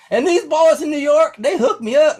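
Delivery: high, loud hollering imitation of a local; Orville retelling it. Local bragging about the band years later, high hollering imitation. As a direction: crow it like a proud small-town storyteller. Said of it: "is still telling this story".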